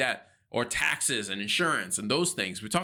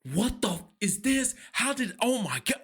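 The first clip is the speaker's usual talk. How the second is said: high, panicked shriek of shock; sudden pitched-up panic; retold freak-out; 11 semitones higher than usual. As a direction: squeal it out, losing it at the puddle. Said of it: "I lose it".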